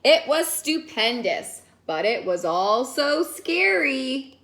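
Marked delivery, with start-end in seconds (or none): none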